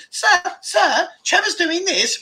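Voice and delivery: high-pitched tattling pupil; smug schoolboy. Acted. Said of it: "little smug schoolboy classroom".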